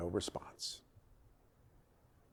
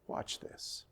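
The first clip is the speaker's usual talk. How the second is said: hushed, knowing aside; whisper; 15 dB quieter than usual. A narrator's reading. Elijah teasing the crowd before the fire falls, whispered. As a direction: lean in and breathe it, confident.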